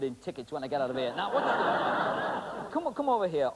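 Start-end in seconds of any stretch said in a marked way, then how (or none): none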